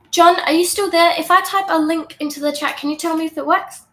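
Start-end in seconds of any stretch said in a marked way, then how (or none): 0.1-2.8 calling out higher and louder. Real, not acted. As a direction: call across the room to John. Calling out to a co-host.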